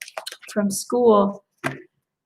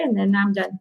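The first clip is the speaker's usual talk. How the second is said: ordinary delivery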